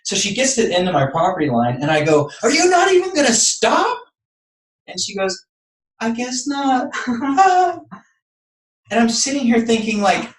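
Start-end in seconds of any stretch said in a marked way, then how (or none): 2.4-4.0 very high, incredulous retold outburst; livid. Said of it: "I'm even more livid at this point". 6.0-7.9 high put-on voice ending in a laugh; mock her breezy brush-off, pitched up; imitated flippant neighbor. Mimics neighbor's reply, then laughs.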